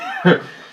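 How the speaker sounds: squealing laugh; amused laugh; high laugh. Real, not acted. Laughing at himself.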